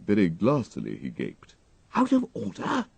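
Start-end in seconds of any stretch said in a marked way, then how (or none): 1.9-2.9 high, stunned question; aghast disbelief. A narrator's reading. Wooster hears the car is broken, stunned disbelief. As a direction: gape at the news. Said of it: "gaped at me. Very glassily".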